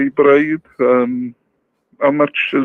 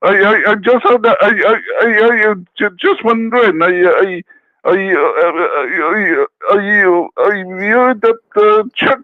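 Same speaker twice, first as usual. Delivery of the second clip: lightly put-on Irish accent, higher and stammering; 4 semitones higher than usual. Caller phoning a radio show in a light mock Irish accent. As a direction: play a bumbling Irish caller, stuttering I, I, I. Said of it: "Irish O'Rourke".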